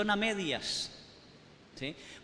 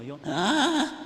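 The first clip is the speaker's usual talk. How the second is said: mock cry; 6 semitones higher, 4 dB louder than usual. Acts losing control.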